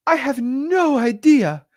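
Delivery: high, slow put-on Victorian accent; prim mock Victorian accent, drawn out; "I have no idea" in a slow, high fake Victorian accent. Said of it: "in their, like, fake Victorian accent".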